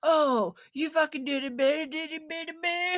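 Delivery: high, sing-song taunting voice; mocking jeer. Critics jeering at whatever she says, high taunt. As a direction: taunt like a playground bully. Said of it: "are like".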